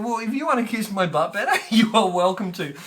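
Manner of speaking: loud laughing flirt; a cheeky offer with a laugh.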